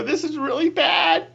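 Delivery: high, loud, slow wail; hammed-up whine of despair; comic dread. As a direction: wail it in mock misery. Acting out his dread, comic.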